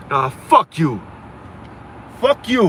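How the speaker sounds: loud, high fuck-you, spat out; defiant snap. Real, not acted. Streamer telling haters who want a handout off, loud and high. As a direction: throw it back in their face.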